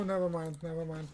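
ordinary delivery

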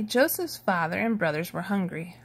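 plain reading voice

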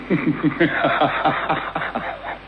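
airy, silent-ish scornful laugh; breathless mocking laugh. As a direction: laugh at his gullibility under your breath. Mocking Holmes' mistake.

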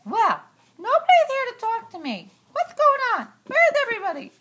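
normal talking voice

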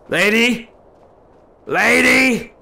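loud, rising call to someone far off; calling out to the lost lady; shouting after her. Real, not acted. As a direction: yell to get her attention. Shouting after the wandering escort.

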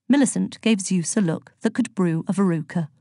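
usual speaking voice

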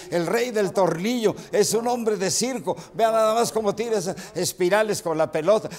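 high, loud play-by-play proclamation; match commentary. Retold or imitated. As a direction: call the player's trick to the crowd. Relives his match commentary. (narración de partido, voz alta y exaltada)

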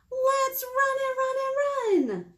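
high, excited story voice; excited squeal. Acted. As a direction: squeal it like a pumpkin ready to run.

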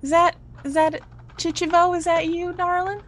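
high, gentle character voice; sweet lady, pitched right up; tender Ursula. Acted. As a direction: ask gently, as if recognizing someone.

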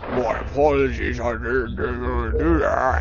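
a hint of a put-on creature voice, slow at the end; half-hearted monster apology, drawn out; terrible Oracle voice. Shown as a demonstration. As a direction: lightly voice the monster pet, badly. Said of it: "that's like a terrible voice".

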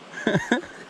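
short high chuckle; laugh. Real, not acted. Fisher chuckling over a fat catch, laugh.

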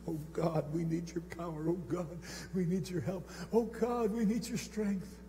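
a hint of an old man praying; lightly voiced, pleading old man's prayer; elderly prayer murmur. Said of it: "sounded like an old man praying".

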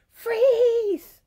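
high, very loud, drawn-out mock yell; monster's yell. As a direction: holler it like a storybook monster. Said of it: "big scary monster yelled".

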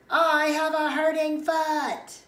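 high, loud, slow call; shouting from behind. Acted. Child lagging behind on the walk home, shouting. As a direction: holler it to someone far ahead. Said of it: "so I had to shout".

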